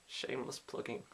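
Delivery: quiet self-mocking aside; hushed mock shame; muttered aside. Real, not acted. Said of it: "self-aware aside".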